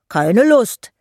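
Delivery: put-on sulky boy calling out; called out. A narrator's reading. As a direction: call it back in a huff. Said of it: "rief Jockel".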